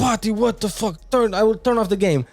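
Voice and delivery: high-pitched imitation of a fed-up dad; exasperated dad. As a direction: scold like a dad at his gaming kid. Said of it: "my dad would be like".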